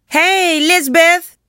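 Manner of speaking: high, loud call across the yard; yelling boy. A narrator's reading. Joey calling to his sister from among the bored kids, yelling. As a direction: holler her name out high and hard. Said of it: "Joey yelled".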